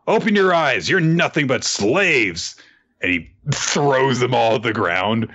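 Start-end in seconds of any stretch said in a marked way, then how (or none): 0.1-2.5 rousing speech. Retold or imitated. Retold Luffy speech to prisoners.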